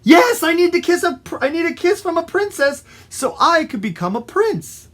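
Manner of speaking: high, loud, eager gush; high-pitched eager character voice; excited frog. Acted. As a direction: blurt out your great wish. Frog excitedly answers the fox.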